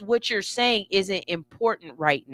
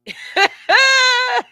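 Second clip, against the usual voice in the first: big high whooping laugh; a shrieking cackle of delight; loud laugh; 9 dB louder than usual. Real, not acted. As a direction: burst out laughing at yourself. Bursting laugh at her own boast.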